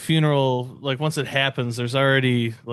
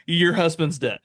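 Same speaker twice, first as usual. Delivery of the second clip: much higher, breezy tone for terrible news; mock cheerful cop; 5 semitones higher, slower than usual. Friend mimicking a cop who breaks a death to a widow too casually. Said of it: "a cop shows up ... been like".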